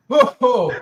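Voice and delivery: high, loud burst of pain; loud pained whoa. Real, not acted. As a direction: yelp it out as the burn peaks. Chip pain peaks.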